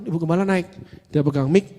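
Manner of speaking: usual speaking voice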